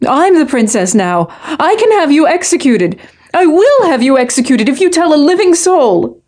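scared princess threatening, pitch climbing high. A narrator's reading. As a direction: voice rising into a warbling, frightened threat. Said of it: "her voice rises, warbling high and scared".

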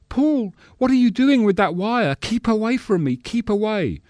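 very high-pitched, pleading panic; stage terror. Acted. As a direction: plead like a melodrama victim fending off a killer. Horror-play victim pleading with her attacker.

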